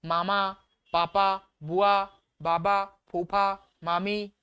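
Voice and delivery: very slow, lips pressed on each word; slow lip sound demo. Shown as a demonstration. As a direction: spell the words out with the lips. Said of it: "केवल ओंठ से आवाज आ रही". (होंठों से धीरे-धीरे बोलकर)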